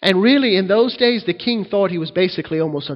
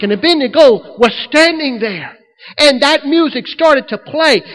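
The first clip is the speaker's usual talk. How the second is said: louder and higher, pounding each never; preaching at full force; 8 semitones higher, 6 dB louder than usual. Acted. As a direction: preach it out to the congregation, rising.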